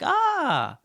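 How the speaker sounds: high, loud drawn-out "Ah!"; sudden understanding. Retold or imitated. McDonald's staff finally getting the order, high realizing "Ah!". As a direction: act out the penny dropping, high. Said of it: "and they were like".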